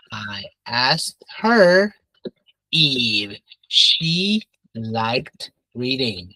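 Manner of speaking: very slow, word by word with pauses; slow modelling. Shown as a demonstration. Teacher modelling a reported question for the class, slow and deliberate. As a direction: spell the sentence out slowly for learners.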